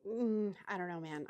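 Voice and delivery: usual speaking voice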